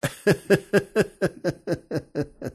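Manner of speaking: long run of chuckles; real laugh. Real, not acted. Joke teller after his own punchline, laughing.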